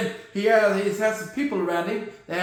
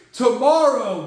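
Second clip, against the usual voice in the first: ordinary delivery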